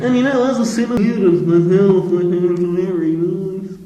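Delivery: pompous put-on voice, high then slow; hammed-up dignitary; mock city leader. Podcaster hamming up a city leader, pompous voice. Mock posh civic speech voice.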